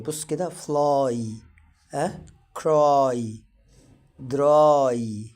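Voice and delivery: each word said alone, y spoken as a clear ai; fly, cry, dry said loud and clear for students to copy; modeling y as ai. Shown as a demonstration. Teacher models words for Egyptian students, loud and clear. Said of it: "دايمًا عامل زي الآي".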